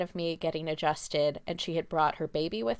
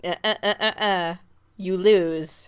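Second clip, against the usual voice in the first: louder teasing game-show buzz, then a smug verdict; playful shutdown, like a quiz host; mock buzzer dismissal; 6 dB louder than usual. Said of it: "I'm like".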